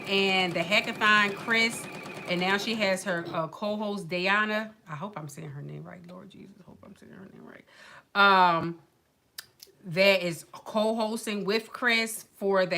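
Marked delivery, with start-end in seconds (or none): 4.9-7.7 hushed aside. Real, not acted. Host privately hoping she got the co-host's name right, hushed aside. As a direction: drop to a soft, worried mutter.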